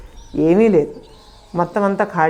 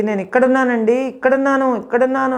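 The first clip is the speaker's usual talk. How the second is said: higher, raised desperate call; shouting for help; 5 semitones higher than usual. Retold or imitated. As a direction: call out to the farmer by name. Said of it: "అని గట్టిగా అరుస్తున్నాను". (రైతును పిలుస్తూ గట్టిగా అరుపు)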